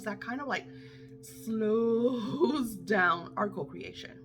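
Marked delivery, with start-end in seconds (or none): none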